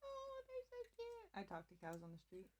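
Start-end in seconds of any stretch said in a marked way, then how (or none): 0.0-1.3 slow, much higher cooing; gushing. Real, not acted. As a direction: coo over something cute. Gushing over cows.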